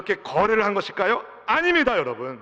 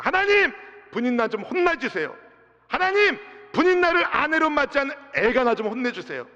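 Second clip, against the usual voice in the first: a hurt wife begging God to punish her rival; pleading complaint; 8 semitones higher than usual. Acted. Hannah complaining to God about her rival, pleading.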